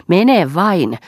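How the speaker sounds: loud, high mock-send-off shout; shouting after him. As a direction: fling it after him as he leaves. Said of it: "huusi hän".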